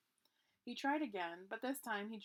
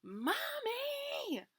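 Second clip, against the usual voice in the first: calling out. Acted. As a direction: cry out for mommy from far off. Stuck Grover calls for mother.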